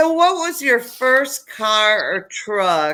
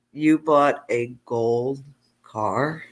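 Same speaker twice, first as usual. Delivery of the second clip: slow, low, hushed "you bought a gold car?"; a son who can't believe what's in the carport; stunned disbelief; 5 semitones lower, 5 dB quieter, slower than usual. Retold or imitated. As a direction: let the shock drain the voice as you drag it out. Jaw-dropped at dad's gold car.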